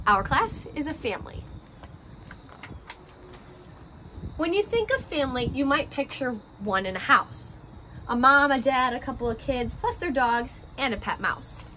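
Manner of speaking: gentle picture-book read-aloud, rhymes bouncing along; reading to children. A narrator's reading. Teacher reading a class picture book to her first graders, story-time voice.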